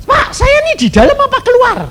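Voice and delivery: high-pitched frantic plea, acted out; loudish, high retold panic; panicked caller. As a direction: mimic someone anxiously begging for an answer. Voices panicked man asking pastor.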